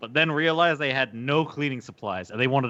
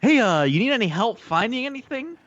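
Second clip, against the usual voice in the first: mock-friendly clerk greeting, pitched higher; overly friendly clerk; 9 semitones higher, 3 dB louder than usual. Sales associate greets a known thief, mock friendly. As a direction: fake helpful customer service voice. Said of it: "It's like".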